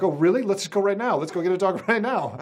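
high, eager, laughing; eager reply. Retold or imitated. Answering his partner's dog offer, high and eager. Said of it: "I'm like".

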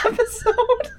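high breathless laughter; can barely get it out laughing; laughing hard. Real, not acted.

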